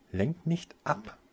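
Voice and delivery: high, slow sharp rebuke; snapping at him. A narrator's reading. Winona snapping at Taso for dodging, sharp rebuke. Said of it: "fuhr Winona ihn an".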